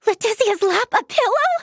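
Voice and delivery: gasp, then rising high disbelief; flustered astonishment. Acted. Offered a friend's lap as pillow, astonished. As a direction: repeat it incredulously, voice climbing.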